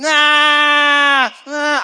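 loud, high wailing cry of a hungry newborn; mock baby wail. Preacher imitates his newborn crying at night, loud mock wail. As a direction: wail it like a baby screaming for milk.

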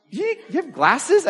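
slow, high, disbelieving question; a teacher realizing the kid can't see; surprised teacher. Retold or imitated. Teacher to student, asking in slow, high disbelief.

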